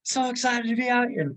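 mock ecstatic scream, loud and high; elated screaming. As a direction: imitate yourself yelling with elation. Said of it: "screaming out of elation".

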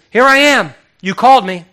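high, loud and eager reply; eager loud answer. Acted. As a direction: call it out as the boy reporting in. Boy Samuel answering Eli, acted.

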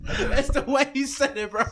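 giggling while defending himself; words broken by a real laugh. Real, not acted. Cohost explains himself to the host, laughing. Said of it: "I didn't mean to laugh".

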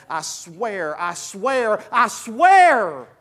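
escalating plea, last one long and loud; desperate to be believed. Acted. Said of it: "wanted somebody to believe you so bad that you would say".

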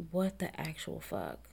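soft, slow disbelief; hushed what the fuck; mock shocked. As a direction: mutter it in mild disbelief. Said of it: "Even the women were like".

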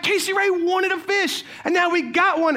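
high-pitched thrilled announcement. Retold or imitated. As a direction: announce it excitedly to the whole party. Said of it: "I start raising my voice".

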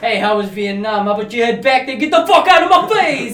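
rising into a loud high yell; mock angry rant. Friends retelling the chiropractor's rant, mock angry yell. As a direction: build from a taunt to a furious mock scream.

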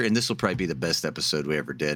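ordinary talk, nothing marked

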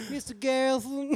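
high, whining New Orleans protest; put-on movie character voice; Joe Pesci character. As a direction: plead it high, playing the film part. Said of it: "I would do the Joe Pesci character".

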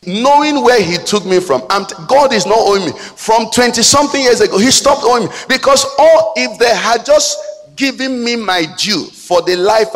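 high, loud pulpit preaching; impassioned preacher in full cry; full-force preaching. Acted.

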